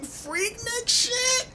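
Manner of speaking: squeaky slowed squeal; high squeal. Real, not acted. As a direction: squeal the word out. Squealed outburst.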